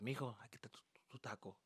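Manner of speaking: very soft whispered mom imitation; hushed mom. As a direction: whisper it like a mom to her son. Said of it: "my mom was like".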